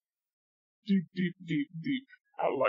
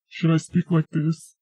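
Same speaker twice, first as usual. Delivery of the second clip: ordinary talk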